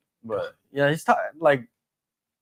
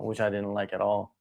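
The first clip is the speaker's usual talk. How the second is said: ordinary talk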